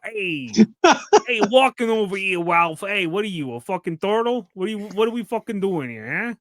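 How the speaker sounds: hammed-up New York wise-guy accent. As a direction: make fun of a New Yorker's accent. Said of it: "That felt stereotypical for some reason".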